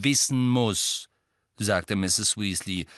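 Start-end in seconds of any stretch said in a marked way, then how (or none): 0.0-1.1 „wissen muss“ langsam und nachdrücklich; „wissen muss“ jedes Wort betonen; Betonung auf wissen muss. A narrator's reading. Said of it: "betonte die letzten beiden Wörter nachdrücklich". („wissen muss“ mit Nachdruck)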